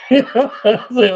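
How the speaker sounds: high, loud, quick laugh through the words. Real, not acted. A man laughing at himself as the unbreakable, high and loud. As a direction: crack up.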